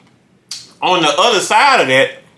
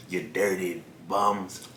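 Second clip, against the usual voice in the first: soft, slow teasing murmur; drawn out, hushed, playfully; mock ASMR tease; 10 semitones lower, 16 dB quieter, much slower than usual. Teasing his viewers with a slow, softer ASMR murmur. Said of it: "Do some A S M R".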